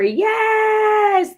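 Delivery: high, loud cheering yes; excited cheer. Real, not acted. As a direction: celebrate a viewer's finish out loud. Cheering a viewer's news.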